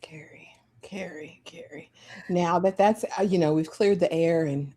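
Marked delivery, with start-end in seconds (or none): 0.0-1.9 hushed whisper calling a name. Acted. Host playfully summoning Carrie in a whisper. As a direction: breathe the name softly three times.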